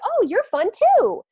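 high, slow delighted puppy; happy puppy voice. Retold or imitated. Puppy answering the owner's call, high and delighted. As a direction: gush back like a happy pup. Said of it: "They're like".

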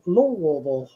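Louisville squashed to "LOO-vul"; local pronunciation. Shown as a demonstration. One friend offers the local way of saying it, Louisville slurred as "LOH-vul".

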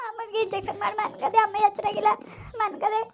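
high, weepy answer; tearful. Acted. Granddaughter telling grandmother about sick mother, tearful. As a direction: answer while crying. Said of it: "ಅಳ್ಬೇಡ, ಸುಮ್ನಿರು".

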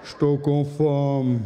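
low, slow droning repeat; deadpan mimicry. Guy in the shop droning that he is hungry, low and slow. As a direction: drone it out flat and slow like a bored guy. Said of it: "dois gajos durante meia hora assim".

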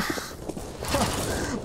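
airy unvoiced chuckle; breathy laugh. Real, not acted. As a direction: laugh silently through your breath. Laughs at own failure.

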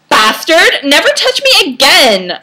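loud, high melodramatic cry; hammed-up indignant shout; outraged gasp. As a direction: fling it out in mock horror. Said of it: "I gasped".

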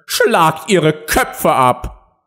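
high, very slow execution order; commanding queen. A narrator's reading. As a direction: draw out the order imperiously. (befehlende Königin)

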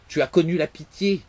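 furious accusation. Acted. The father condemns his son's pity, loud and scornful. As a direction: accuse him with contempt. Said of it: "le roi, irrité et implacable".

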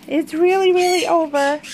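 final goodbye, "really" punched; stressed really. Shown as a demonstration. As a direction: lean on "really", "really over". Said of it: "now it's really over".

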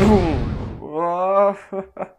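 high laugh. Acted. Character laughs.

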